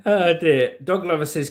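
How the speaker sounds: normal talking voice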